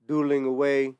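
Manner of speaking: ordinary talk, nothing marked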